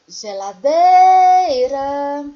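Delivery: "Geladeira" sung loud with DEI held long; sing-song stretch. Shown as a demonstration. Teacher showing the tonic syllable of Geladeira, loud sing-song stretch. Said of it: "o dei da palavra geladeira".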